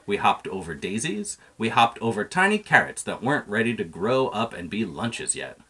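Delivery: usual speaking voice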